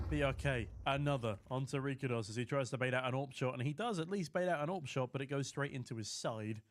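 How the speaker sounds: ordinary talk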